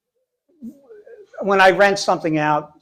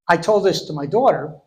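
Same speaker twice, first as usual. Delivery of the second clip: ordinary delivery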